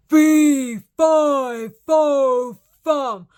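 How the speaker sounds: big, drawn-out storybook giant chant; giant's shout. A narrator's reading. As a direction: start loud and high, easing off. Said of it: "he shouted".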